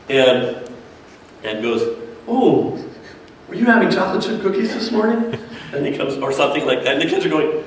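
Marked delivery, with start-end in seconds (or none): 2.3-5.4 high, put-on kids' show voice, playfully curious; Bear. TV bear sniffs out what viewers ate, playfully inquisitive. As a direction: coo a pitched-up question right at a small child.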